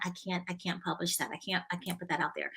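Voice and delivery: soft, hesitant refusal; retold quiet protest; reluctant refusal. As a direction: say it small and hushed. Retells refusing to publish.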